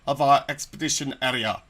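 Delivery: loud, grand adventure narrator; grand narration. Acted. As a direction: proclaim it like an explorer's log. Reads game text in narrator voice.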